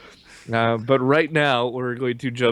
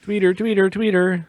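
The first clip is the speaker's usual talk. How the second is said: playful sing-song; 8 semitones higher than usual. Pretend.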